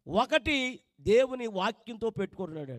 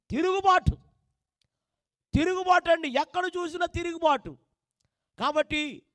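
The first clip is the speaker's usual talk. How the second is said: loud, raised preacher's call; full-force preaching; 7 semitones higher, 8 dB louder than usual. Acted. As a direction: drive the sermon home to the congregation. (గట్టిగా ప్రసంగం)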